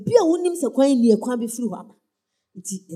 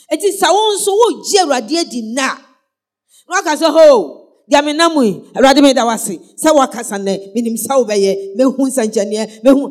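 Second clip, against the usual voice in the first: loud testimony cry of thanks; preacher voicing a thankful believer, loud; fervent preaching; 3 semitones higher, 10 dB louder than usual. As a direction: shout the believer's praise. Preacher voices grateful believer.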